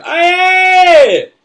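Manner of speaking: high, full-voice war cry; proud scream. Acted. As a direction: scream it with all your pride. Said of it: "I scream like the Indian that I am".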